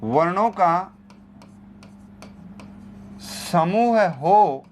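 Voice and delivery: वर्णों का समूह हो drawn out slowly word by word; slow dictation. Shown as a demonstration. Teacher slowly dictating the condition to students. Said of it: "इसमें शर्त है".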